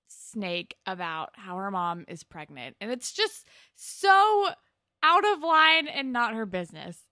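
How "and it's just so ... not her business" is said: loud, high, slowed-down outrage on "so"; outraged exasperation. Real, not acted. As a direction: drag out "so" in loud disbelief. Outraged at Emma's meddling.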